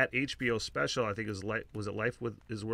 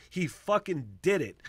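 breaks into a loud high laugh; 7 semitones higher, 13 dB louder than usual. Real, not acted. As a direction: laugh out the news with delight. Laughs at the spoiler.

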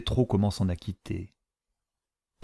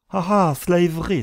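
high, louder exclamation; exclaiming; 10 semitones higher, 6 dB louder than usual. A narrator's reading. The mother exclaims about the ball, high and louder. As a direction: cry out in alarm. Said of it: "s'écria la mère".

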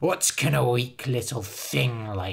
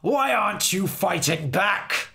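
higher, louder frustrated cry; fairy cries; 4 semitones higher, 4 dB louder than usual. Acted. Fairy King cries at Harold who won't fight back, angry. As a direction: demand an answer in anger. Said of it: "cried the fairy".

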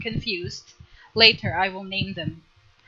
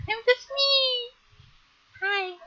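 much higher, put-on cutesy voice; squeaky cute voice. Streamer to chat, insisting she's ordinary in a squeaky voice. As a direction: squeak it out like a shy cartoon. Modest giggly self-description.

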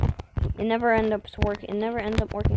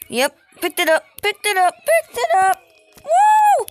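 building louder, higher cheer; triumphant cheering; 8 semitones higher, 7 dB louder than usual. Real, not acted. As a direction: gloat rising to a whoop.

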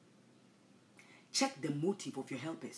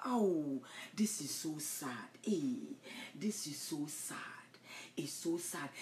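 mock sad whisper; 3 semitones lower, 5 dB quieter, slower than usual. Mock sorrowful aside.

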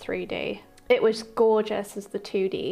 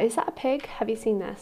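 normal talking voice

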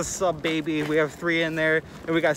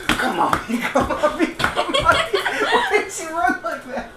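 laughing hard; 13 semitones higher, slower than usual. Real, not acted. Laughing hard at her running.

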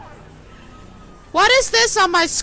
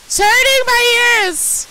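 loud complaint; 9 semitones higher, 7 dB louder than usual. Real, not acted. Game noise hurting ears.

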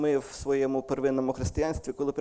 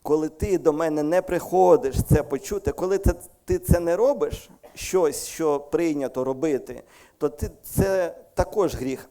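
raised, louder preaching voice; preaching at full force; 4 semitones higher, 6 dB louder than usual. Acted. Preacher voicing God's rebuke to the congregation. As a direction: drive the warning home.

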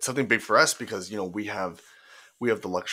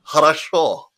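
loud, hammed-up Russian accent; high, jokey Russian accent; 8 semitones higher, 11 dB louder than usual. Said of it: "Russian accent".

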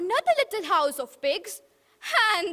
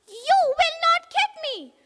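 much higher, louder pig cry; defiant little pig; 13 semitones higher, 6 dB louder than usual. Acted. Pig defying the wolf, high and loud. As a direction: squeal it out in defiance. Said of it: "the pig cried".

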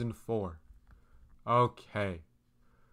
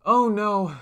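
alarmed; 9 semitones higher, 8 dB louder than usual. Real, not acted. Password suddenly on fire.

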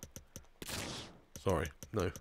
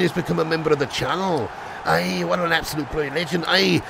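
celebratory mock-Scottish patter; Scottish accent; 2 semitones higher than usual. Streamer celebrating a new channel member, playful Scottish accent. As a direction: cheer them on in a put-on Scottish brogue.

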